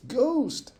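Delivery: high, loud frightened yelp; panicked yelp. A narrator's reading. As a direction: scream it while running away.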